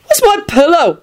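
high, sulky complaint; sulky moan. A narrator's reading. Neville wants his pillow, sulky moan. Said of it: "he moaned sulkily".

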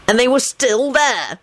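slow, high, relishing enthusiasm; drawn-out delight; enthusiastic. A narrator's reading. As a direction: relish it with lifted pitch. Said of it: "he said enthusiastically".

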